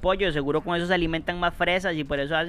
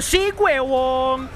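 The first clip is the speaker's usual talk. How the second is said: triumphant cheer; 8 semitones higher, much slower than usual. Real, not acted. Gamer cheering after surviving the fight, dragged-out triumphant yell. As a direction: stretch it out and celebrate.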